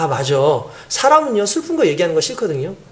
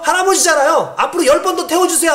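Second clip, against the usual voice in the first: put-on higher, earnest child's voice; small child; 7 semitones higher than usual. Little boy asking the driver to let the old man ride. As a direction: plead sweetly like a little kid.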